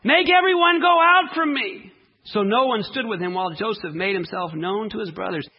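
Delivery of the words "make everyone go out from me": high, loud cry of command. A narrator's reading. Joseph cries out to clear the room, loud high cry. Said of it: "and he cried out".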